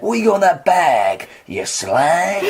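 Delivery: gruff London heavy, cracking into a laugh; rhyming hardman. Pretend. Atlas taunting Perseus in rhyme, gruff and laughing. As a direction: threaten in rhyme like a London thug. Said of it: "Atlas talks in rhyme".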